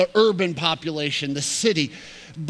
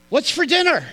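calling out; 10 semitones higher, 6 dB louder than usual. Retold or imitated. Husband calling at kitchen door.

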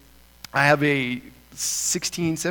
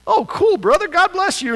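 much higher, louder gushing welcome; cheery agent; 8 dB louder than usual. Retold or imitated. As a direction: play a delighted border agent beaming at him. Border agent greets the missionary.

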